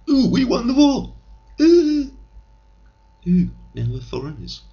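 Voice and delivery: squealing high mock American; mock American glee. Comic Englishman mocks Americans celebrating war victory, squealing high. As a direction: lampoon the Americans, shrill with joy.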